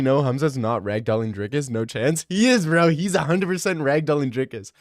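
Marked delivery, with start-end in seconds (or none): 2.3-4.7 louder, higher, emphatic claim; loud insistence. Real, not acted. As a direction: push it hard, totally sure. Insisting loudly.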